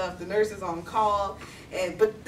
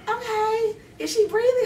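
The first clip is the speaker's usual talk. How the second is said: high, loud dispatcher voice; phone operator; 11 semitones higher, 5 dB louder than usual. Retold or imitated. Operator checks on unresponsive grandmother, high role voice. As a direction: imitate the operator, pitched way up. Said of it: "so she's like".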